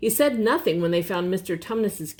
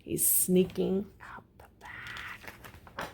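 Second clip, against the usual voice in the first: whisper; 9 dB quieter, much slower than usual. Real, not acted. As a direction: whisper the secret, slowly.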